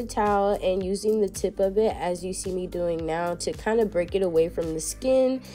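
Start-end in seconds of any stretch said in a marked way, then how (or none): none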